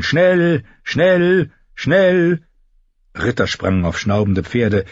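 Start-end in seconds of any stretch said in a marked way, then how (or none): 0.0-2.4 high, drawn-out cry; ringing, raised-pitch summons; blaring trumpet call. A narrator's reading. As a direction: sound each word like a horn blast. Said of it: "Wieder gellten die Trompeten".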